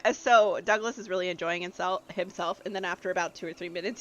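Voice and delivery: normal talking voice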